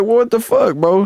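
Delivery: high-pitched retold disbelief; mock high-pitched outrage; indignant protest. Retells protest at his new seat.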